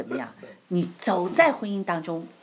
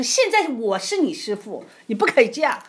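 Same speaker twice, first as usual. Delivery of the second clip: louder, higher mock rebuke; imitating a domineering instructor, raised and loud; bossy instructor; 8 semitones higher, 9 dB louder than usual. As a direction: lay down the law as the new instructor. Quoting the scolding driving instructor.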